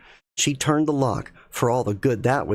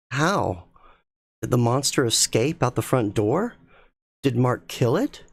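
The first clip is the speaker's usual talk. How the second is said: ordinary delivery, nothing marked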